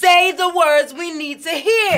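high, drawn-out, forceful shout; slow, loud courtroom badgering; loud demand. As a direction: hammer each word at the witness. Lawyer demands witness answer louder.

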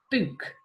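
book said whole with a short oo; blended book. Shown as a demonstration. Teacher blends book after sounding it out. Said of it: "Like the word".

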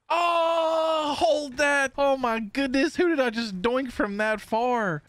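high, elated crowing; triumphant shout. Real, not acted. Player landing a long throw, high triumphant shout. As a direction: crow over an amazing hit.

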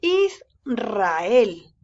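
"Israel" split as Is... rrael; strong rolled R. Shown as a demonstration. Teacher modelling R after S, strong rolled R. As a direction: trill the R of "Israel" after a held "Is". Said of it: "lo leemos con un sonido fuerte".

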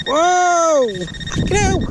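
ordinary talk, nothing marked

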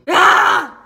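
sharp high loud yelp. Real, not acted. Vlogger yelps loudly at the camera, a sharp cry. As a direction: let out a sudden high yelp. Sudden outburst.

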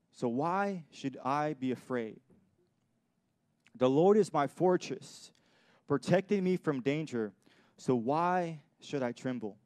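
slow, solemn reading of a psalm; scripture reading. Acted. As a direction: proclaim each line slowly to the congregation.

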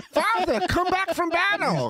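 put-on high whiny kid cry; mock child voice. Spartan kid begging his father, high mock wail. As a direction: squeal it like a clingy little boy.

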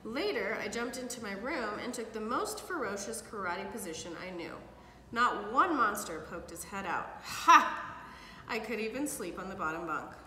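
put-on lively read-aloud for kids; storybook reading. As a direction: read it like bedtime story to children. Parent reads picture book aloud.

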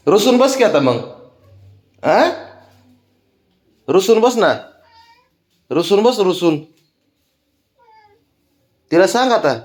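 high, singsong teasing to the pet. Real, not acted. Man teasing the sulking pet to go sulk, high singsong teasing. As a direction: coax the sulky pet with mock glee.

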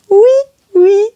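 oui oui sung high and cheerful like a cartoon name; Noddy's name. Shown as a demonstration. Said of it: "the character is called".

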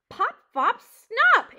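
high, loud impatient shout; crying out. Acted. Porcupine fumbling the mouse's name, crying out. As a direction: fire out each name loudly. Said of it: "Aerith cried".

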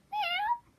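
a high, squeaky kitten meow; cat meow. Pretend. Introducing Sydney the cat.

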